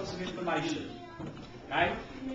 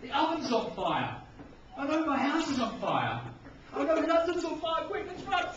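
a startled baker, panic building, rising higher each line; baker drama; 6 semitones higher than usual. Shown as a demonstration. Baker who wakes up to find London on fire, rising panic. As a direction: act it out, growing alarm and climbing pitch. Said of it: "Maybe you're the baker".

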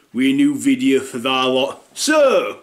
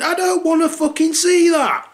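much higher strained outburst; exasperated yell; 14 semitones higher than usual. Retold or imitated. Dad yelling at son showing his downstairs. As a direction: shout it in horrified disgust.

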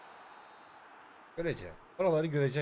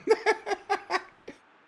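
low, soft words giving way to giggles; trying not to laugh; 9 semitones lower, 5 dB quieter than usual. Real, not acted. Player tells himself to stop laughing, cracking up. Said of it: "gülme".

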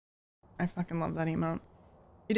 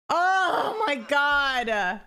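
stunned; 6 semitones higher, 4 dB louder, much slower than usual. Real, not acted. Still reacting to the surprise.

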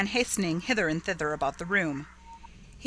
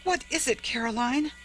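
high, horrified exclamation; horror-stricken; 6 semitones higher than usual. A narrator's reading. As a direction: appeal to Caroline in horror. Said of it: "whose horror-stricken tone matched her sister's".

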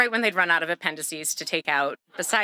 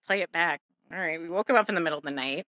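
ordinary talk, nothing marked

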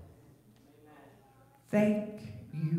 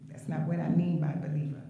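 usual speaking voice

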